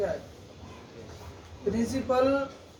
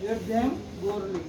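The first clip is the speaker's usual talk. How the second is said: very slow, soft reading of the answer; dictation; 3 semitones lower, 4 dB quieter, much slower than usual. Shown as a demonstration. Teacher reads the converted answer to students, slowly. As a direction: read the answer slowly for the class to copy. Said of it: "converted sentence read out".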